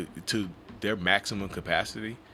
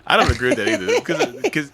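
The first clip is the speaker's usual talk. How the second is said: louder, higher laughing objection; laughing protest; 8 semitones higher, 9 dB louder than usual. Real, not acted. Answering her co-host's joke, laughing loud and high. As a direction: burst out laughing while disagreeing.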